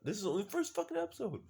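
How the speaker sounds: high-pitched, rushed fan gushing; giddy, squeaky outburst of enthusiasm; real excitement. Real, not acted. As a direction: burst out, thrilled and fast. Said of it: "I'm excited".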